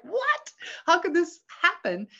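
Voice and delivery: high-pitched, disbelieving outcry; re-enacted shock. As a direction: cry out in mock disbelief. Acting her shock at the arrest.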